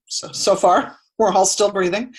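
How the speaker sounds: joking aside, laughing lightly; amused. Real, not acted. Meditation teacher jokes with her class about breathing, amused.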